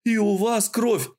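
высокий испуганный вскрик; ахни, увидев кровь; испуганный возглас. Acted. Парень замечает кровь. (испуганно вскрикивает)

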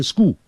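"school" cut short as "skoo"; wrong "school" without L. Shown as a demonstration. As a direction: drop the L from "school". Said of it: "不要念成school".

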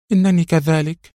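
high, plaintive admission; miserable. A narrator's reading. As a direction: admit your misery with a sigh's weight. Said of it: "زفرت على نحو ضاعف من قلقه".

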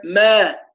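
ما light and unemphatic; thin mim. Shown as a demonstration. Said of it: "جميلة".

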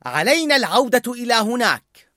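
voiced brightly as the girl, set and sure; firm. A narrator's reading. Joy firmly tells Bing Bong they must go back. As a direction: state the plan with no room for doubt. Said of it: "وقالت بحزم".

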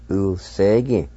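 Ulsegi said slowly and evenly; Cherokee pronunciation. Shown as a demonstration. Teacher repeats Ulsegi for learners to copy. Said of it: "twice in Cherokee".

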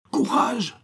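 very high-pitched distant call; crying out. A narrator's reading. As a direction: call encouragement across the water, high and stretched. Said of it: "cria Raoul".

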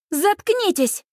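high, indignant command; fed-up high cry; exasperated outburst. A narrator's reading. Mother snaps at mocking boys.

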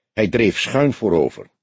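plain reading voice

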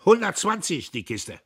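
high, loud, curt officer; loud high lieutenant voice; clipped officer voice. A narrator's reading. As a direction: bark it like a brash lieutenant. Lieutenant repeats price.

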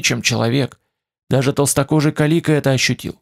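ordinary delivery

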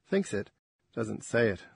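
ordinary delivery, nothing marked

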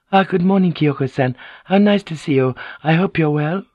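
high, polite social greeting; pleasant. A narrator's reading. Lady greeting Kyoko in passing, pleasantly. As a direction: sing out a courteous good morning. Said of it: "she said pleasantly".

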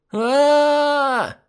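put-on a girl shrieking at full pitch; child's shrill scream. A narrator's reading. Girl cuts her finger, screams high and loud. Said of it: "завопила в диапазоне ультразвука".

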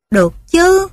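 high, quick imp reply; eager little imp. Acted. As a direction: chirp it like a small devil. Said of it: "imp answers eagerly".

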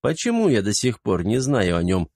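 ordinary delivery

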